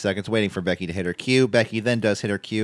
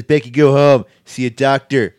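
louder, higher mock command; stern retold order; 4 semitones higher, 9 dB louder than usual. Triple H sends Becky home to see a doctor, stern and loud. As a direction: imitate an authority sending someone home.

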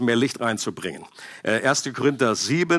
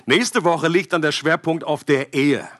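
usual speaking voice

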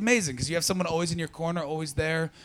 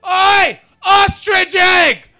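put-on high, loud drunken bellow from a pub; mock Glaswegian drunk hollering; drunk shout; 13 dB louder, much slower than usual. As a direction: yell the insult across the pub like a drunk. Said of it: "this pissed up guy went".

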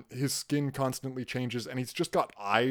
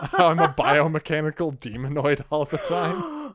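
laughing; 9 semitones higher, 6 dB louder than usual. Real, not acted. Joking about robot cosplay, laughing.

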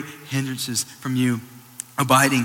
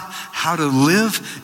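ordinary talk, nothing marked

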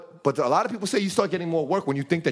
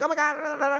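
higher pitched, gushing fan squeal; mock fan excitement; 11 semitones higher than usual. Mimicking fans going crazy at a rap show, mock gushing. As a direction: ham up a starstruck fan.

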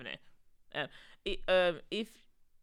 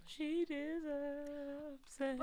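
laughing; 7 semitones higher, 10 dB louder, much slower than usual. Real, not acted. Echoing friend, laughing.

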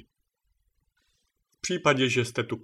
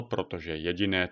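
usual speaking voice

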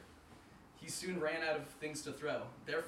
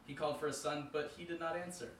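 plain reading voice

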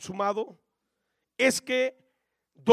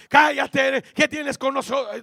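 a touch of a shrieking demon, higher and louder; demon crying out; 5 semitones higher, 9 dB louder than usual. Acted. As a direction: voice the demon's defiant outcry. Démons qui crient à Jésus.

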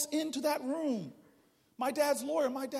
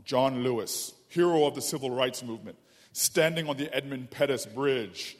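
solemn grand announcer intro; epic documentary narrator; 8 semitones lower, 10 dB louder than usual. Retold or imitated. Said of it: "The, the starts off".

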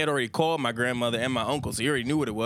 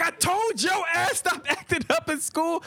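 high, slow mock shout of an angry uncle; mock yell; slower than usual. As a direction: bark the scolding slowly, word by word. Uncle yelling while whipping.

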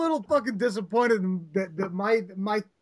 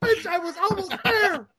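high, exasperated shouting; mock frustrated yell; 10 semitones higher than usual. Slapping the TV to clear the static, a mock frustrated yell. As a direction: yell at the static like you were so close. Acted frustrated porn viewer.

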